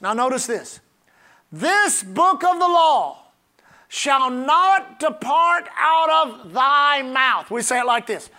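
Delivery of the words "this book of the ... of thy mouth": very slow, weighty Bible proclamation; emphatic scripture reading. Real, not acted. Preacher reading the key verse to the congregation, slow emphatic proclamation. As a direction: declaim it word by deliberate word.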